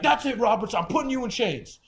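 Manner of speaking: high, loud imitation of a threatening guard; officer's angry threat. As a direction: threaten him like a fed-up jailer.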